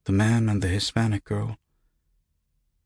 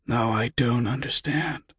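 higher-pitched raspy croak; rasping; 6 semitones higher than usual. A narrator's reading. Confused patient answering the unseen voice, rasping. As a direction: force it out through a dry throat. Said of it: "he rasped".

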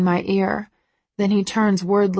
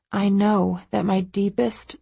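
plain reading voice

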